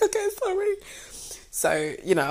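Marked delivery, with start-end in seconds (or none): 0.0-0.7 very high squeaky apology; mock-weepy squeak; retold tearful apology. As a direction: imitate apologizing through tears. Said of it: "like, I'm crying".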